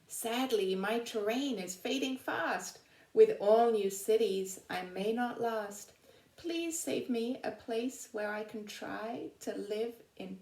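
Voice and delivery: lively storybook poem reading; reading a poem aloud. Acted. Teacher reading an animal's poem to her class, lively storybook voice. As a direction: read it to the children like a rhyme.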